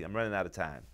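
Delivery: ordinary delivery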